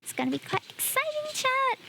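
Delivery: excited. Real, not acted. Hyping chat for pack opening.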